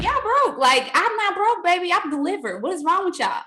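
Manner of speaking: high, sassy mock of the pastor; sassy preacher quote. Quoting the pastor to her team, high and sassy. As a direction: tease the church like the preacher did. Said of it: "he told the church, like".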